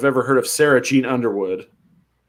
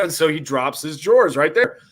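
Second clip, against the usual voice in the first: ordinary delivery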